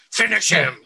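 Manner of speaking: high, loud game announcer voice; Mortal Kombat announcer. Retold or imitated. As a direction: announce it like an arcade fighting game. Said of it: "you have the Mortal Kombat sound".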